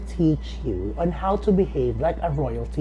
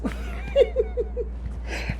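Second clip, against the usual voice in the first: very high trilling royal laugh; diva laugh. Acted. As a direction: laugh like an amused queen. Queen's laugh.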